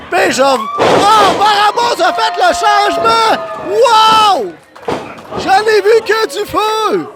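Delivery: high, thrilled commentator shout; excited shout. Real, not acted. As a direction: yell it like you can't believe it. Sudden tag switch in match.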